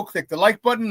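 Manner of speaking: normal talking voice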